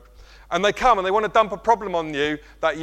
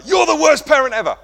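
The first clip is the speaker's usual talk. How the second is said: mock child's angry shout, higher and louder; imitating a yelling child; 11 semitones higher, 11 dB louder than usual. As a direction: throw it like a sulking kid.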